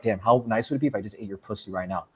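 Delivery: soft, low, sultry; low seductive murmur.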